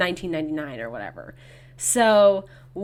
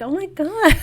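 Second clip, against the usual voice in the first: mock shock; 10 semitones higher, 4 dB louder than usual. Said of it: "it's like".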